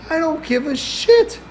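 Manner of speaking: very high-pitched, indignant protest; acted-out comic fury; exasperated outburst. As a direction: cut off his excuses, voice shooting up. Retold quarrel, cutting off excuses.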